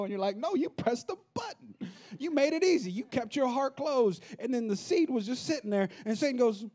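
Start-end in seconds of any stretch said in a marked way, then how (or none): 0.4-4.2 much higher, slow scolding; exasperated retort. Retold or imitated. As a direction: scold them like a fed-up parent. Pastor's retort, high and emphatic.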